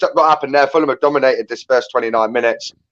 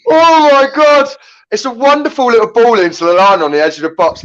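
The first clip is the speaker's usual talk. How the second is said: much higher, louder, thrilled shouts; excited commentary; 11 semitones higher, 4 dB louder than usual. Real, not acted. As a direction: yell it out like a live commentator. Near miss in live commentary.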